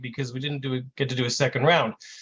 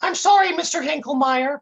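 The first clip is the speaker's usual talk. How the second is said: put-on much higher voice for a dim kid; 11 semitones higher, 4 dB louder than usual. A narrator's reading. Intern apologizing to the agent, high kid voice. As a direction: pitch it way up, like a sorry kid. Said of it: "the kid says in this high voice".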